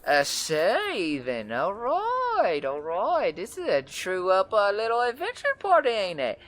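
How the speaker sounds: very high, loud, bubbly woman's voice; excited red-haired woman. Acted. Wiry red-haired woman greets the adventurers, very high and thrilled. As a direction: bounce in thrilled to meet them. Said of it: "woman reacts to the party".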